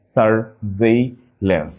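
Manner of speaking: slow "surveillance" as sur, vei, lance; syllable by syllable. Shown as a demonstration. Teacher showing spelling, "surveillance" slowly split. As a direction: pause between syllables of "surveillance". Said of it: "just একটু ভেঙে নিব".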